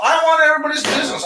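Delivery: fast, high, loud declaration; fired-up shout. Real, not acted. Arguing with a friend about business, fast loud shout.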